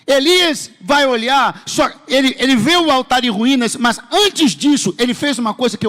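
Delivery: high, loud, stretched pulpit call; forceful preaching. Real, not acted. Preacher resuming the story of Elijah, loud forceful preaching. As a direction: launch back in loudly, drawing out key words.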